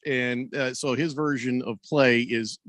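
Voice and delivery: ordinary talk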